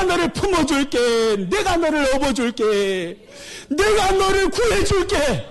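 high, pleading call to come home; voice of God. Acted. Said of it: "하나님은 어떠하시겠어요". (돌아오라 간절히 부르는 하나님의 높은 목소리)

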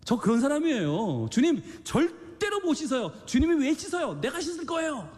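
high, insistent protest of a stubborn disciple; preacher acting out Peter's proud refusal, high-pitched; Peter refusing stubbornly. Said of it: "라고 할 때".

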